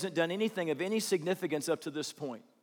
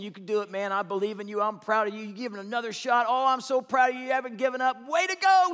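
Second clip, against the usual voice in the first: rising, louder encouragement building to a high cheer; proud dad cheering; 5 semitones higher, 4 dB louder than usual. Retold or imitated. Dad cheering his son on the ski slope, building excitement. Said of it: "all day long is the dad saying".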